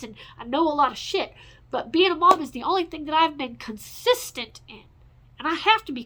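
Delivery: loud and high, insistent and worked up; real exasperated venting; heated rant. Real, not acted. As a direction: hammer home that this is all she knows. Ranting about motherhood as identity.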